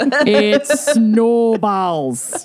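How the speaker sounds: put-on slow drawn-out Minnesotan accent. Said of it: "if we're doing Minnesota accents".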